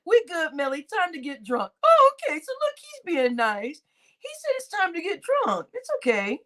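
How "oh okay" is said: surprised. Real, not acted. Reacting to a viewer's comment.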